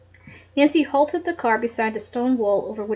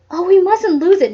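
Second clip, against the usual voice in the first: higher and louder urgent exclamation; exclaimed; 8 semitones higher, 8 dB louder than usual. A narrator's reading. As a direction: blurt it out in alarm. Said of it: "Nancy exclaimed".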